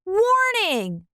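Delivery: high, urgent "Warning!"; a label shouting warning; warning call. A narrator's reading. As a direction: announce the warning sharply.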